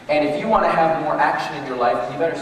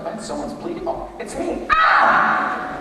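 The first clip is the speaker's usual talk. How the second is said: calm then a sudden yelp of surprise; startled realization; 3 dB louder, slower than usual. Pretend. Worker spotting his own blood, startled yelp.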